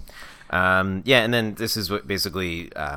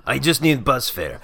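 slightly higher, louder, drawn-out character line; lightly hammed-up, slow quoted plea; movie-line delivery; 5 semitones higher, 5 dB louder, slower than usual. Broke guy asking her for bus fare, hammed-up movie line.

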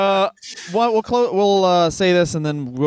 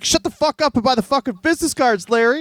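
mock angry salesman; 8 semitones higher than usual. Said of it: "And he's like".

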